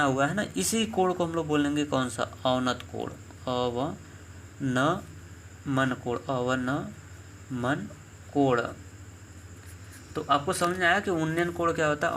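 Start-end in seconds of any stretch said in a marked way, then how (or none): 3.5-8.6 "अवनमन कोण" very slowly, syllable by syllable; teacher slowly sounding out "अवनमन कोण"; slow dictation. Shown as a demonstration. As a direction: draw out each part of "अवनमन कोण" with gaps. Teacher spells out the term slowly.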